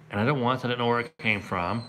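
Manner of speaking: normal talking voice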